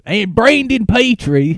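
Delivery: high, slow, drawn-out British call; hammed-up accent on the name; mock British accent. Host hails the battler, high mock British accent. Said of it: "That's my British accent".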